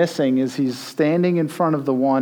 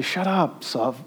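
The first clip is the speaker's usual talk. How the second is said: dismissive hush; 2 semitones higher, 5 dB quieter, slower than usual. Shown as a demonstration.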